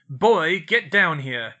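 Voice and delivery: loud bellow up the stairs; hollering. A narrator's reading. Vernon calling Harry down the stairs, loud holler. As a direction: holler up to the boy upstairs. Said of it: "hollered up".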